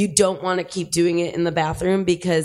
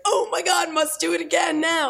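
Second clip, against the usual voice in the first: desperate urgent; 11 semitones higher than usual. Retold or imitated. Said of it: "I'll be like".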